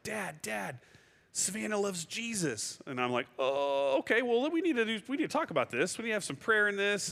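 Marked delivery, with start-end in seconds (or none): 0.0-2.8 lightly voiced young son, high and eager; a hint of a small boy bursting with news; excited little boy. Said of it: "he's like". 3.4-7.1 much higher, voice cracking with emotion; overwhelmed dad fighting back tears; choked up. Retold or imitated. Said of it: "And I'm weeping".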